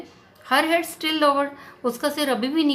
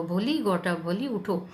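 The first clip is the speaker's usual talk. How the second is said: usual speaking voice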